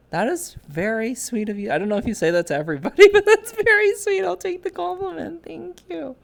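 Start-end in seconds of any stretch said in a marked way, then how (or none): 3.1-6.2 flattered giggle. Real, not acted. Flattered by chat compliment.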